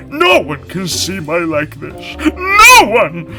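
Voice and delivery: loud, slow, hammed-up wailing lion; a vain lion lamenting his trapped paw melodramatically; moaning lion. A narrator's reading. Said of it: "The lion moaned".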